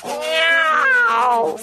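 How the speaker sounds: long high cat meow; meowing in protest; shouted meow. Acted. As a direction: cry out a high, drawn-out miaow. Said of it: "φώναζα εγώ".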